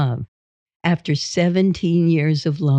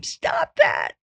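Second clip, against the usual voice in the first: imitated scream. Imitated wife screaming at husband chasing her, high squeal. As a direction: squeal it in a sky-high pitch. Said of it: "She'd scream".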